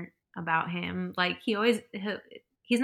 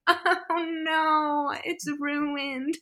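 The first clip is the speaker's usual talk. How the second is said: mock despair; 9 semitones higher, 4 dB louder, much slower than usual. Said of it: "I'm like".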